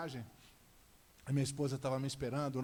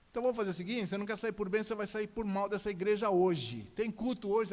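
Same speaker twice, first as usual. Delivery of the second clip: loud, high ultimatum; bully her with raised voice; threatening father; 6 semitones higher, 6 dB louder than usual. Retold or imitated. Father gives daughter ultimatum.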